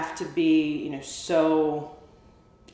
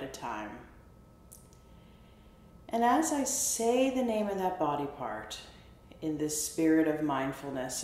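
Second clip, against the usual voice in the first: soft, unhurried meditation voice with long pauses; guided body scan; 6 dB quieter than usual. Real, not acted. Therapist guiding listeners through a body scan, soft meditation voice. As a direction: softly call out each body part, leaving space after it.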